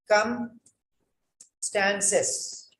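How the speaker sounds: circumstances stretched very slowly, syllable by syllable; slow syllable breakdown. Shown as a demonstration. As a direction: draw circumstances out to show where it splits. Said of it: "This is two syllables".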